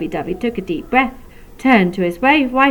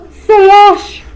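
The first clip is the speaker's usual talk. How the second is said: a splashing, raised "slosh" for the rising flood; loud high slosh; 10 semitones higher, 8 dB louder than usual. Acted. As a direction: make the water sound big. Tears rising to their chests.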